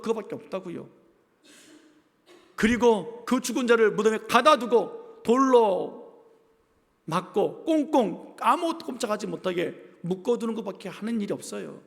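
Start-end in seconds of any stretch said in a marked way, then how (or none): 2.6-11.8 slow emphatic preaching. Acted.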